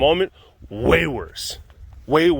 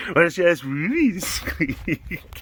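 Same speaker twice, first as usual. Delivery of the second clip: sulky dog voice; 3 semitones higher, slower than usual. Pretend. Dog voice, taunting.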